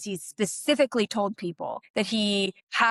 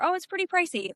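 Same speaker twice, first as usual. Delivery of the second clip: much higher, light, shrugging it off; modest understatement; 9 semitones higher, faster than usual. Retold or imitated. Answering a question about the island's cost, breezy and modest. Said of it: "the tone of your voice".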